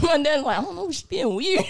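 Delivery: can barely get it out for giggling; laughing while quoting. Real, not acted. Daughter retelling her parents' reaction, laughing.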